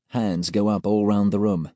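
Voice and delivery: usual speaking voice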